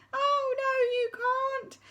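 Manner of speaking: high sing-song panto reply; chiming chorus. Acted. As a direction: chime it like a whole hall. Panto exchange.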